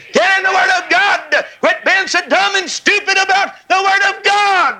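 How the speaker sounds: ringing pulpit shout; shouted rebuke. Real, not acted. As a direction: thunder it at the lazy preachers. Preacher shouting rebuke at preachers.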